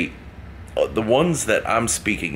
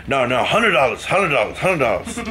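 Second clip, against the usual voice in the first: put-on drunk Irish mumble; 6 semitones higher than usual. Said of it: "kind of an Irish accent, but mumbling".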